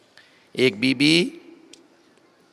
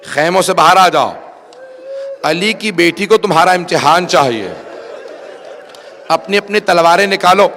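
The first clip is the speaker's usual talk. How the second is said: loud, raised rallying call; 3 semitones higher, 6 dB louder than usual. Retold or imitated. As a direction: summon the companions at full voice. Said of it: "جناب حبیب نے آواز دی". (بلند آواز میں للکار)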